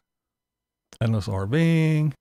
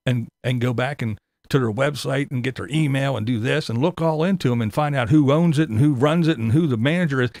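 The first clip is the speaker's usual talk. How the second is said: ordinary talk, nothing marked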